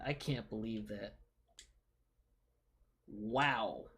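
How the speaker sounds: normal talking voice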